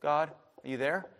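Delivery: soft, low, mild query to God; quiet, unhurried asking; tentative call. Acted. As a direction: knock gently with your voice. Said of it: "Sometimes you just go".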